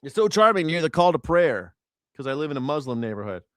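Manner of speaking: ordinary talk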